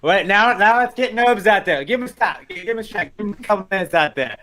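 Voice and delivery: high-pitched excited pleading; urging fan. Retold or imitated. Fan begging for Noble's minutes, high and eager. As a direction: urge the manager on in a high, insistent voice.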